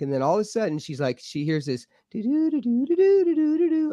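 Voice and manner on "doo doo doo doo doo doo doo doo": high sing-song doo-doo-doo sliding up and down; imitating a wavering voice. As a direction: mimic a voice swooping high and low. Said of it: "her voice went, you know, high and low".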